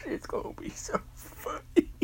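high, giggly words squeezed out between laughs; a high, giggling aside; laughing through it. Acted. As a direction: try to speak while cracking up. Laughing at his own promise.

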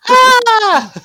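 high, loud mock shriek; hammed-up fright cry; mock scream.

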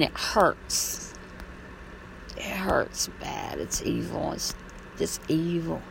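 hushed, slow whisper; slow pained whisper. Real, not acted. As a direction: drop to a whisper, slowly. Pained remark about abuse.

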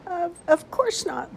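high, slow squeak, stammering nervously; a nervous little mouse squeaking; squeaky voice. A narrator's reading. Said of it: "Sam said in a squeaky voice".

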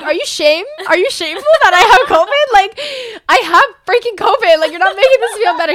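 high, very loud retold protest; exasperated shouting. Daughter with covid confronting disbelieving dad, exasperated shouting. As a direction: yell it in hammed-up indignation.

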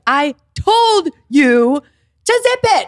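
high, loud, slow and emphatic; mock scolding. Daughter telling off mom for spreading the secret, high loud exasperation. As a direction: scold with each word drawn out.

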